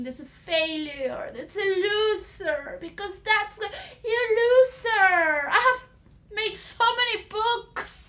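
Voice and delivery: crying, pitch climbing high and loud; tearful outburst. Acted. Philosopher sobbing to the crowd about being called a loser. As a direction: sob it out as the hurt builds. Philosopher breaks down over critics.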